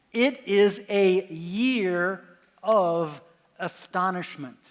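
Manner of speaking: very slow, word by word, with a pause before OF; slow, weighty reading. Shown as a demonstration. Lecturer revealing the inscription's answer to the class, slow and weighty. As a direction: let each word land as a revelation.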